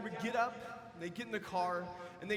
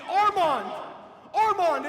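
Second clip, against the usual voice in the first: much louder, higher calling of the name; desperate yell; 7 semitones higher, 13 dB louder, slower than usual. Retold or imitated. Father calling into the rubble for his buried son, desperate yell. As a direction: call into the rubble for your son. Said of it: "he begins yelling".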